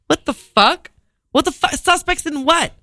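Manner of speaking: can't believe where this went; reacting to four suspects, high disbelief. Real, not acted. Shocked reading news.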